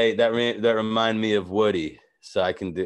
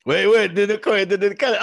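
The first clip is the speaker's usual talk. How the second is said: ordinary talk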